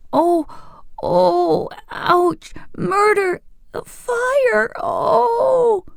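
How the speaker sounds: high, wailing cries of pain. A narrator's reading. Boy in pain cries out to friends, high wailing howls. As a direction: howl each word like a boy in agony. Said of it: "rolled upon the bottom of the nest in such contortions".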